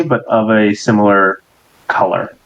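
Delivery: usual speaking voice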